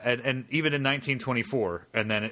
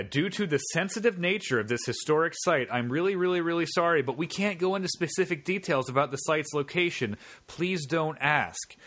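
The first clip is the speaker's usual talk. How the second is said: mock official statement; 6 semitones higher than usual.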